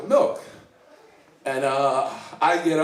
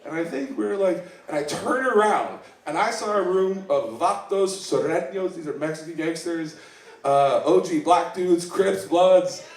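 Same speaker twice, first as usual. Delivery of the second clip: ordinary talk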